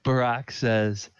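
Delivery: ordinary talk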